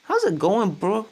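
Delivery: "How's it going?" run together fast, as "haz goi", with a high rising "bro"; fast casual speech. Shown as a demonstration. Teacher imitating fast-talking Americans greeting a friend, rushed and casual. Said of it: "Quand les gens parlent vite, bah, ils disent".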